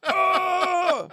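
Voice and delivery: long, high hammed-up scream; mock scream. Wallace's head on the bridge, mock agonised scream. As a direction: scream in exaggerated agony.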